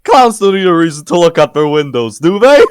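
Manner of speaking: clown voice. Acted. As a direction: play the goofy clown witness. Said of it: "more jovial stint on it".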